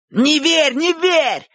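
very high, insistent cry; urgent shout. A narrator's reading. Shurik, keenest on Bocho's victory, shouts urgently. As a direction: shout a warning to your champion. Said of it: "крикнул Шурик".

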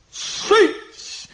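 high, half-voiceless hissed snap; snapped hush. A narrator's reading. Elegant devil silencing Ivan, snapped hiss. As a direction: hiss it out sharply. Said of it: "рявкнул изящный чёрт".

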